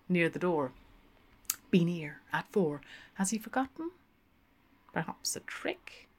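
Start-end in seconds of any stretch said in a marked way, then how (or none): 4.9-6.1 hushed unvoiced inner thought; whispered doubt, no voice at all. A narrator's reading. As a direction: breathe it as a secret worry.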